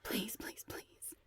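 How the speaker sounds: desperate whispered pleading; whispered begging. A narrator's reading. Alex begs the phone while redialing, whispering. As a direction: beg under the breath, fading.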